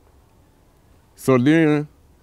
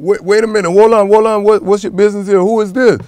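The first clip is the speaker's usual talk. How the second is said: higher and louder, reliving the alarm at the door; retold alarmed challenge; 12 semitones higher, 10 dB louder, faster than usual. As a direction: demand to know who is there, raised and urgent. Retelling confronting intruders at door.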